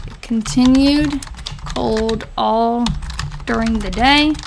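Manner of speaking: word groups spaced out as if typing; slow dictation. Real, not acted. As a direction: read it slowly and softly, pausing between chunks. Typing out diary entry aloud.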